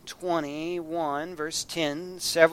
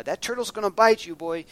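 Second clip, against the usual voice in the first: higher, louder scolding mother voice; scolding mom, quicker and raised; mama's warning; 5 semitones higher, 7 dB louder than usual. Retold or imitated. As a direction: warn the boy like his mama. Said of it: "My mama used to tell me".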